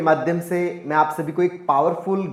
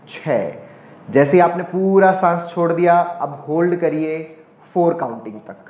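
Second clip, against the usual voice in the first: pace each instruction and number to a steady breath; guided meditation. Shown as a demonstration. Yoga teacher counting viewers through inhale, hold and exhale, guided meditation. Leads a timed breathing exercise.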